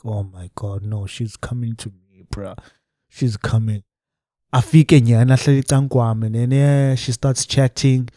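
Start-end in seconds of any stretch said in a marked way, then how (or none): 0.0-3.8 low, very soft aside; hushed dread. Retold or imitated. Telling a mate she's walking over, hushed dread. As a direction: mutter it under your breath as she approaches. Retells panicked aside as she approaches.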